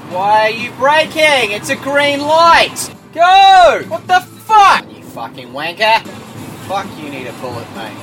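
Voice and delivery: furious road rage shouting. Real, not acted. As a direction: scream at the driver ahead. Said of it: "I see what you guys mean".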